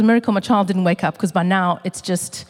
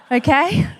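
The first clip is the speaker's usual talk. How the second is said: light laugh through the word; laughing okay; 4 semitones higher than usual. Real, not acted. Preacher laughing at the tent memory, laughing. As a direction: chuckle at her own joke.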